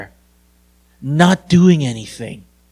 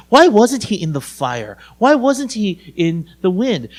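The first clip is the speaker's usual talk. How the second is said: ordinary talk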